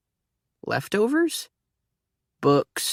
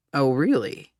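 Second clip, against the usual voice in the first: ordinary delivery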